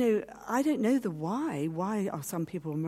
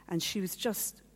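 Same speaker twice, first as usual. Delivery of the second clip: usual speaking voice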